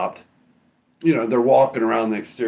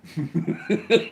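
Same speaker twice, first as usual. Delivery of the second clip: laughing; 9 semitones higher than usual. Real, not acted. Amused at bum.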